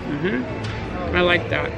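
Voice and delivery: normal talking voice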